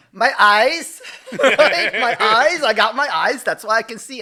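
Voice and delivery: high, loud comic outburst; exasperated answer. Retold or imitated. Son answering dad's riddle, mock exasperated shout. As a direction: shout the obvious, hammed-up.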